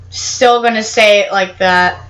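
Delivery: ordinary delivery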